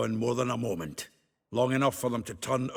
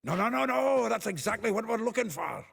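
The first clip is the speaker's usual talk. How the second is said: excited outburst; 8 semitones higher than usual. Real, not acted. Finds Dandelion's planner excitedly.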